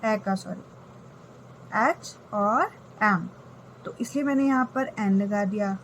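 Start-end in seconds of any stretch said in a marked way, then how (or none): 1.8-3.3 एच और एम said slowly, letter by letter; slow demonstration. Shown as a demonstration. Teacher sounding out letters slowly for the class. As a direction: spell out एच and एम slowly for students. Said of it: "एच एम बोल कर देख लो आप".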